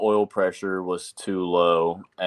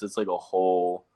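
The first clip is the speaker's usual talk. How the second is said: ordinary talk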